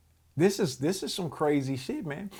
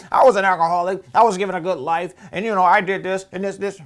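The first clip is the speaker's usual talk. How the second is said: louder, insistent imitation of her; mocking the judge; 2 semitones higher, 7 dB louder, faster than usual. Retelling the judge's unempathetic reply, mockingly loud. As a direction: fire it off loud, imitating a self-centred lecturer. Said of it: "that's what this woman did".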